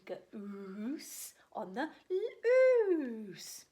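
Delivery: goose on the loose drawn out very slow, breathy and hushed; slow whisper. Acted. Reading the title to children, in a drawn-out whisper. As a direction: stretch each word in a secret hush.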